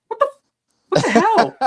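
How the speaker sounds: high, loud, half-laughing what the hell; mock outrage, cracking up mid-line; laughing disbelief. Reading his friend's blunt hospice text, laughing disbelief.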